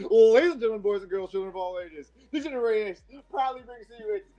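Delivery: high, shouted wrestling ring announcer; ringmaster. Retold or imitated. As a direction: bellow the tag team intro like Road Dogg. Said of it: "if Tim's not gonna do it, I'm gonna do it".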